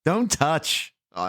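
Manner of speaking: normal talking voice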